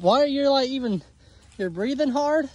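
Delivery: ordinary talk, nothing marked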